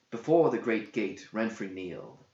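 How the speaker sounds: ordinary delivery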